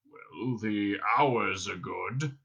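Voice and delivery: low, very slow brute voice; dim Vogon guard. A narrator's reading. Guard pondering his job, slow and dim. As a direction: drag it out, thinking hard.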